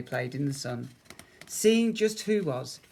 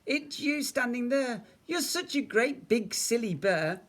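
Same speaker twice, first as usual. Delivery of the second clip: put-on high, teasing child's voice; giggly child; 9 semitones higher, 3 dB louder than usual. A narrator's reading. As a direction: tease the bear with mock giggliness. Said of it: "giggled Eddie".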